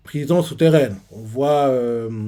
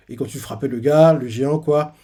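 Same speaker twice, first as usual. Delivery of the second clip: normal talking voice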